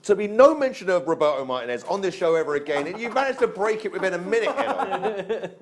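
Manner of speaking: high, emphatic telling-off played for laughs; mock scolding. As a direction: lay down the law in mock exasperation. Host mock-scolds over banned name.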